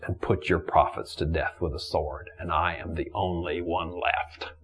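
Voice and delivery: low, soft, downcast reply; subdued answer. Retold or imitated. Elijah answers God that he alone is left, subdued. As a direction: sink low and quiet, phrase by phrase.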